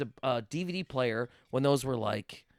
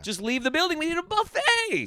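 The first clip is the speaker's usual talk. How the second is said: rising to a high excited cry; high gleeful call to skip work; mock rallying cry; 12 semitones higher than usual. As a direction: hype the buffet escape, going way up.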